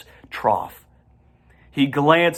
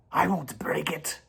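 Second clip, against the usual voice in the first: snarled whisper; 5 semitones higher, 6 dB quieter than usual. A narrator's reading. Templeton snarls back at Charlotte in a hiss. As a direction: snarl it under your breath. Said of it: "snarled Templeton".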